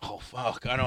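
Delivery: ordinary talk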